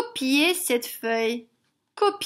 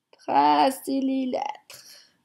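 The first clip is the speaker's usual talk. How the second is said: lent et posé; slower than usual. Real, not acted. Dictating slowly to children.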